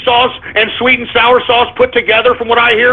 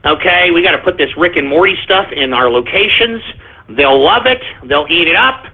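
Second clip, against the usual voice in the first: mock marketing exec; 6 semitones lower than usual. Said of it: "and was like".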